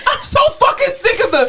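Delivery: high-pitched, hammed-up rant; mock fed-up outburst. Nurse venting about work smiles, mock fed-up. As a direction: squeal out your exasperation.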